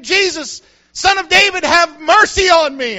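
high, loud pleading cry; Bartimaeus. Retold or imitated. Blind beggar calling to Jesus for mercy, loud cry. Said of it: "it got louder and louder".

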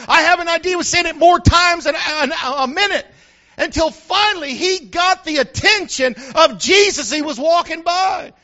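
high, loud pulpit delivery; full-force preaching. Real, not acted. Pastor telling the congregation what happened, fervent preaching.

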